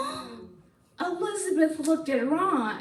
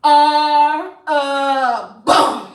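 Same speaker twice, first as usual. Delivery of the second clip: very slow, loud final blow; slow emphatic put-down; 2 semitones higher, 8 dB louder, much slower than usual. Acted. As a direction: drag out are a bum. Final insult to Ron.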